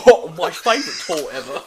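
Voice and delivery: laughing, hammed-up British accent; loud, giggly fake-British impression; Ani's British accent. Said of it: "You have to do a fucking Ani impression".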